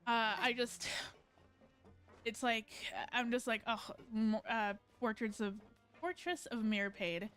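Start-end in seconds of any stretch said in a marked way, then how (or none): none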